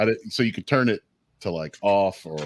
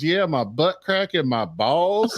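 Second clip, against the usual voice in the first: put-on slow, higher drawling Southern Alabama kid; a little boy in the back seat whining to mama, Southern Alabama accent, slow and higher; 8 semitones higher, slower than usual. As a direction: play the sweaty toddler with a Southern Alabama drawl. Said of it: "super, like, Southern Alabama accent".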